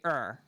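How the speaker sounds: "er" as in "fur". Shown as a demonstration. Explaining the vowel shift, "er" as in fur. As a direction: say "er" in place of ar. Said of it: "they'll say".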